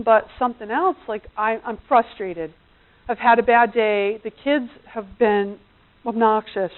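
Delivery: ordinary talk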